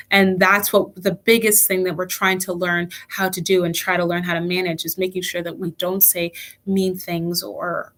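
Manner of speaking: ordinary talk, nothing marked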